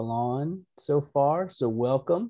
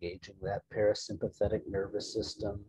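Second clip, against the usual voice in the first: calm, unhurried meditation guide, growing softer; soft-spoken relaxation voice; guided meditation; 4 dB quieter than usual. Real, not acted. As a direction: settle listeners gently, leaving room to breathe. Leads an opening meditation.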